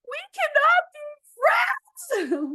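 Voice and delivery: high, loud, slow wail of despair; wailing cry. Acted. Duckling wailing at the bigger crocodile. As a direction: cry it out like a heartbroken child.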